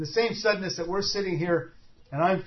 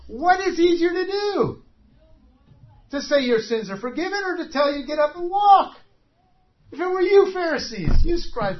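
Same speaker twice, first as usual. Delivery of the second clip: much higher, louder challenging preacher; impassioned pulpit challenge; preaching at full force; 10 semitones higher, 6 dB louder than usual. Acted. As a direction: press the question hard on the crowd.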